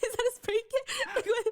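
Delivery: giggling disbelief; laughing. Real, not acted. Host laughs at a meme photo, laughing.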